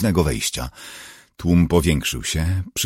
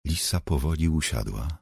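usual speaking voice

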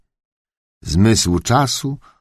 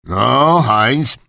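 raised, higher voice of a master; thundering duke; 7 semitones higher, slower than usual. Acted. Duke warning Heinz to watch the dog, booming. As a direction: boom the order at a servant. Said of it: "zagrzmiał książę".

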